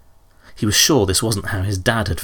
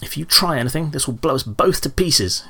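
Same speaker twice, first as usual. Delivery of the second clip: high-pitched, nervous threat; shaky bravado; 7 semitones higher than usual. A narrator's reading. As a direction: bluff bravely while scared. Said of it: "trying to sound braver than he felt".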